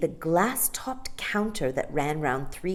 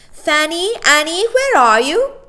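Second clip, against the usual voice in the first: high, loud call into the shop; 13 semitones higher, 13 dB louder than usual. Acted. Nanny calling for the shopkeepers loudly. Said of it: "Mary Poppins called out".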